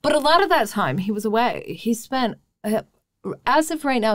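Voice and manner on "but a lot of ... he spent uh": ordinary talk, nothing marked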